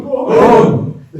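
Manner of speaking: big high shout of boom. Shown as a demonstration. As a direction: bellow it out to lead the room. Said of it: "shout boom as loud as you can".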